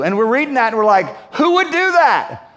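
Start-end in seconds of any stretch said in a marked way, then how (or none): 1.3-2.5 high, drawn-out incredulous question; hammed-up outraged astonishment; mock disbelief. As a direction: exclaim it in appalled disbelief. Acted reaction of shocked readers.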